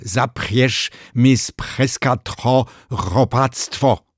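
high, slow outraged lisp; huffy crocodile with a lisp; indignant lisping crocodile. Acted.

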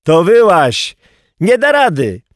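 high, forceful calling; shouted exchange. Acted. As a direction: holler it across a distance. Shouted exchange, boy and Szyper.

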